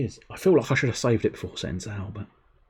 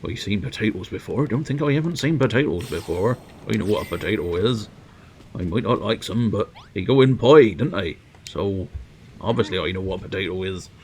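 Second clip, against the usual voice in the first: indignant northern English character; northern accent. Acted. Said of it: "I did it in a northern accent".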